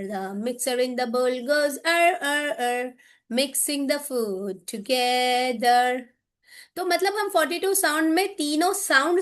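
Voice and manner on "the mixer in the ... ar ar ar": rhythmic classroom chant; mixer chant. Shown as a demonstration. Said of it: "mixer chant".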